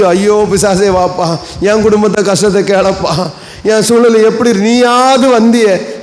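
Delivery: slow, whining mock lament; mock wailing. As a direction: drag it out like someone crying to the devil. Mocking people wailing to devil. (பிசாசிடம் அழுவதைப் போல மெதுவான கேலி ஒப்பாரி)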